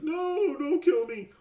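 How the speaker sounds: high, loud mock cry for mercy; mock terrified wail. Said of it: "crying, like".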